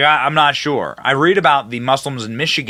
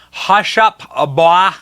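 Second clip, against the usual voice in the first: higher mock-Southern drawl; imitated Southern command, pitched up; Joe Biden's Southern drawl; 3 semitones higher, 3 dB louder, slower than usual. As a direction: echo the quote in a mimicked Southern drawl. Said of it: "Joe Biden says".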